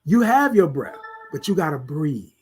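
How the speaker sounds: ordinary talk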